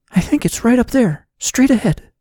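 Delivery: high-pitched, watchful warning; tense warning. A narrator's reading. Heroine pointing out danger to her companion, high and tense.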